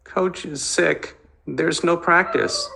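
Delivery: put-on a small hurt boy forcing the words out; suffering voice. Little brother after overhearing the fight, suffering and high. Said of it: "Joey spoke in a suffering voice".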